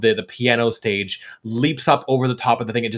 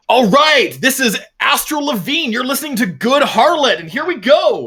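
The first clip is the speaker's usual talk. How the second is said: higher, louder, stage MC call; announcer voice; 7 dB louder than usual. Acted. Head singer announcing into the amplification stone, MC style. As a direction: hype up the crowd like a bandleader.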